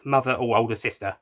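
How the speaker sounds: usual speaking voice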